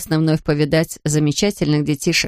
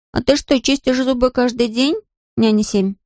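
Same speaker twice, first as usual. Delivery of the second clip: put-on curious little boy; 6 semitones higher than usual. A narrator's reading. Boy Patrick asks the nanny.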